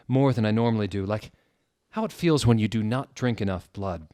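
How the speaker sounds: ordinary delivery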